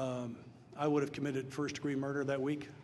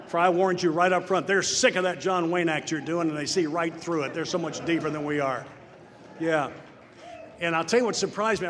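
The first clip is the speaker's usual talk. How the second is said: loud and higher, half-laughing warning to the men; mock-stern joking warning; 3 semitones higher, 6 dB louder than usual. Real, not acted. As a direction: bellow it out as a playful threat.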